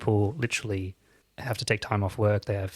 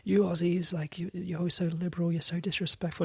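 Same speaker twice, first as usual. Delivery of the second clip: high, hushed imitation of an outraged rant; mock yelling; 8 semitones higher, 4 dB quieter than usual. Retelling an American father's outburst at an Australian diner, mock yelling. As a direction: imitate an angry yell in a higher voice, without the volume. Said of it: "started yelling across the room".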